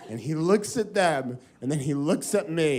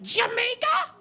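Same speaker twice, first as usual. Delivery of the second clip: very high, excited mock squeal; clueless party-girl squeal; 4 dB louder, slower than usual. Girls' mistaken excited reply.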